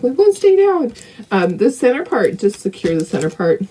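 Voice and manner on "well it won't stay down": imitating skeptics. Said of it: "some people are like".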